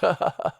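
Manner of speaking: short, high smug chortle. A narrator's reading. Posh teacher amused by his own jibe, chortling. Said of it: "He chortled".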